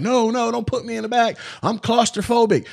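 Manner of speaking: high-pitched retold panic; George freaking out. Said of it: "that's when George starts freaking out".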